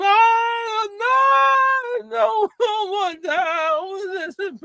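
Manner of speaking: very high, loud shrieking panic; panicked scream. Retold or imitated. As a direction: shriek like you're about to be killed. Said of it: "And this guy screamed".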